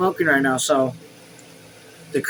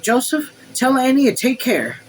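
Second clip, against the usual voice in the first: higher voice of the lady of the house; Madame; 9 semitones higher than usual. A narrator's reading. Madame instructing Joseph, higher voice. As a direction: instruct the servant in a lifted voice.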